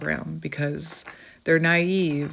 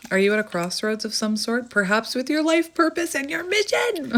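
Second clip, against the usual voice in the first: climbing higher to a gleeful peak; hammed-up excitement; 7 semitones higher than usual. Card reader asks viewer about their life's mission, hammed-up excitement. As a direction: playfully overdo it, pitch rising to the end. Hammed-up reading, then can't help myself.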